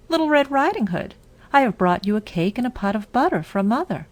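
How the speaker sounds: put-on high, quick little girl. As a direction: chirp it like a young girl. Girl answers at the door.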